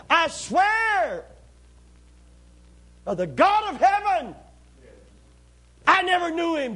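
shouted oath. Acted. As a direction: shout the oath to silence them. Peter swearing an oath, shouting.

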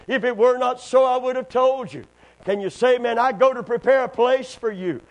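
high, loud preaching climax; preaching at full force. Acted. Pastor urging his church not to fear, preaching hard. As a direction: preach it out to the congregation.

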